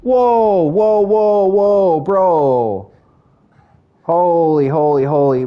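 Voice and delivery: excited whoa. Real, not acted. Reacting to a gift in chat.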